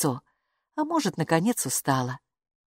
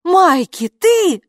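loud, rising high shout of a name; shouted again; 12 semitones higher, 8 dB louder, much slower than usual. A narrator's reading. Hilla shouting toward the opening door, loud. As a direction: call out loudly, asking who came in. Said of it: "снова крикнула Хилла".

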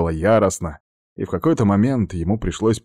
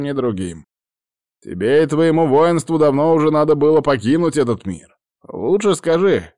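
put-on firm, raised old shaman; 8 semitones higher, 4 dB louder than usual. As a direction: stand firm and command an answer. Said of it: "голос его был твёрд".

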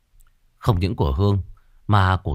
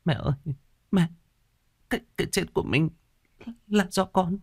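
high, broken sobbing confession; 11 semitones higher than usual. Acted. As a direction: choke the words out between sobs. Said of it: "Kim hức hức từng tiếng".

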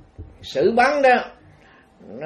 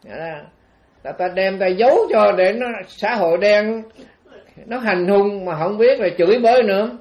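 usual speaking voice

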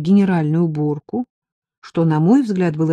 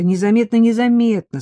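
raised, quick-to-placate repetition; agreeing; 5 semitones higher, 4 dB louder than usual. A narrator's reading. Narrator agreeing to slip away unseen, placating. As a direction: humour her with a double assurance. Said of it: "согласилась я".

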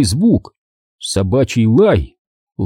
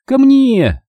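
very high calling command; girl's command; 13 semitones higher, 3 dB louder than usual. A narrator's reading. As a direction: order the dog over, pitched right up. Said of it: "скомандовала Минди". (команда собаке высоким голосом)

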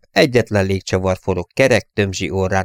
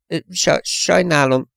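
high, halting stammer of a nervous rookie; 10 semitones higher, slower than usual. A narrator's reading. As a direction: trip over the apology, high and unsure. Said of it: "dadogta a mazsola". (magas, dadogó bocsánatkérés)

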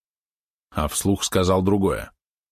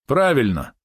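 smirking; 6 semitones higher, slower than usual. A narrator's reading. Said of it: "хмыкнул Розмич".

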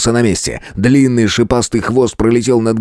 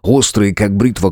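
usual speaking voice

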